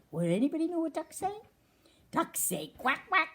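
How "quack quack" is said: mock quack, quack; duck quack. Cork shows what ducks say, mock quacking.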